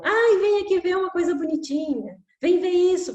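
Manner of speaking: put-on high, coaxing sing-song to distract a child; soothing parent voice. Parent distracting a crying child, high coaxing voice. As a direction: coax the crying kid to look at something.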